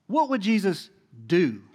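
"do" set apart and stressed; pause, then land firmly on "do". Shown as a demonstration. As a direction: hold back, then give "do" full weight. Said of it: "I'm, I'm really emphasizing the word "do".

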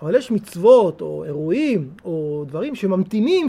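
ordinary delivery

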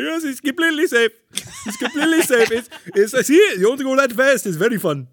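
high, loud, hammed-up Middle Eastern accent; mock Middle Eastern accent. Carnival ride operator reassuring riders, hammed-up Middle Eastern accent. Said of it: "So it's like a guy's like".

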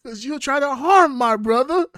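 high, loud furious yell; Pulp Fiction shout. Pretend. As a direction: bellow it like a vengeful hitman. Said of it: "going into a Pulp Fiction".

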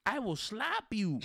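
ordinary talk, nothing marked

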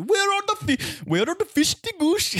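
high, hammed-up fake German accent; mock German accent. Exchange student asking about lunch, mock German accent. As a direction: ask in an exaggerated mock German lilt. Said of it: "That's my full German accent".

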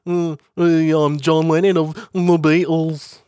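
high, put-on Liverpool voice. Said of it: "[name]'s [name] im".

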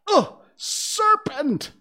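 very high, loud disgusted screech; piercing high-pitched outburst; shriek. A narrator's reading. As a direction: shriek it in revulsion. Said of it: "raising its voice to a shriek".

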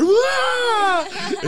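high loud mock shriek of fright; retold shriek. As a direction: shriek like the movie just got you. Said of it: "I shrieked".

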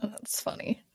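soft unvoiced whisper. Real, not acted. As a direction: breathe it out. Soft aside after joke.

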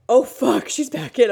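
loud, high exclamation of dismay; re-enacted shocked outburst; imitated alarm. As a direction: blurt it as the startled helper. Acting out helper's alarmed reaction.

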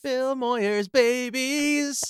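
high, slow, silly singsong. Pretend. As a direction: ham it up like a kids' host. Jokey high singsong introduction.

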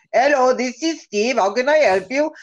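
mock Indian support-line accent; imitated call-center accent. Comcast support agent answering a call, mock Indian accent. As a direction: greet callers in a put-on Indian accent. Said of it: "it's the accent I hear every time".

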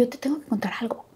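whispered confidence; hushed, confiding whisper. Retold or imitated. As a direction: lean in and whisper the secret. Confiding a secret on set.

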